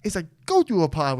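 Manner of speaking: worked-up rant; fired up. Real, not acted. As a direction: get heated about it. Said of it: "what fires me up".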